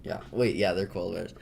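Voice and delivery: usual speaking voice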